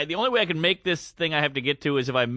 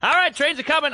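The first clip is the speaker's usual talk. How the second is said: calling out; 8 semitones higher, 4 dB louder than usual. Retold or imitated. Urging couple on stairs.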